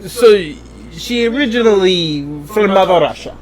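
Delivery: a light, bad Russian accent; terrible Russian accent. Reporting the story in a lightly done bad Russian accent. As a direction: play a clumsy Russian. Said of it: "The terrible Russian".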